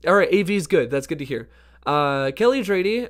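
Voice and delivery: ordinary talk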